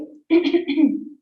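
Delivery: loud cackling witch laugh; evil witch cackle. Acted. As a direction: cackle like an old hag. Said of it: "you guys wanna hear my evil witch cackle?".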